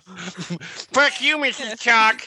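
high, jeering taunt at the teacher; mock taunt. Kid taunting the strict teacher, high jeering voice. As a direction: sneer it like a bratty kid.

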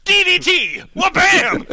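high, loud ringside yell; wrestling shout. Acted. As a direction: call the move at full volume.

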